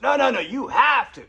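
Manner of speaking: loud high shout; yelling. Retold or imitated. Angry father yelling at Martin at the Kingdom Hall. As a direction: bellow the demand. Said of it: "He's yelling at me".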